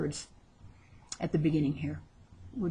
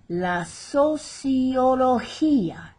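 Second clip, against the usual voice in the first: slow, higher "sociología", stressing the "gí"; stress on final I; 5 semitones higher, 4 dB louder, much slower than usual. Shown as a demonstration. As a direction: draw out "sociología" and lean on the final í. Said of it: "accent over the final I where we stress the word".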